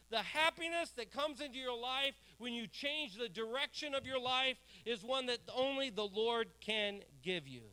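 ordinary talk, nothing marked

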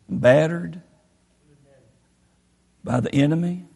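soft, low confession of being beaten; hushed testimony. Retold or imitated. Henry Morehouse tells how the enemy beat him, soft and low. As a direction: quietly lay out your wounds, then name Jesus. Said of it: "Henry Morehouse said this".

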